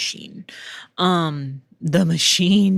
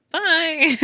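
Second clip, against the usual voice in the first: chirpy goodbye back; 12 semitones higher than usual. Retold or imitated. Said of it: "I'm like".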